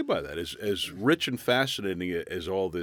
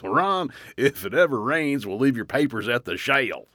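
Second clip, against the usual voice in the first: Texan dispatcher; 6 semitones higher, 6 dB louder than usual. Retold or imitated. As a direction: imitate the dispatcher's Texas accent, saying "shale" for Shell. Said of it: "He meant the Shell gas station".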